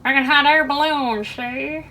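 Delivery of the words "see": ordinary delivery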